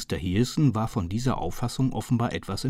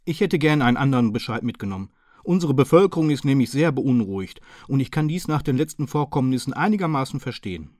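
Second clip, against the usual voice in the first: higher, louder, politely apologetic Hearson; bow and beg pardon with a raised voice; polite apologetic gentleman; 4 semitones higher, 6 dB louder, faster than usual. A narrator's reading. Hearson apologising to the chief inspector, higher and politely.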